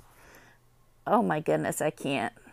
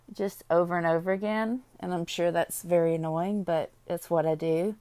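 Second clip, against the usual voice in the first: ordinary talk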